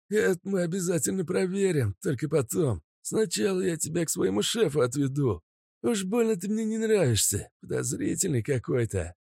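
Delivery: high, sneering bandit voice; menacing thug voice. Acted. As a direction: threaten with a gun, jeering. Mazut threatening Kostya.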